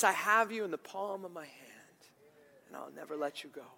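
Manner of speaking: soft reassuring voice of God; tender promise. Acted. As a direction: hush it like a promise. God's promise, voiced softly.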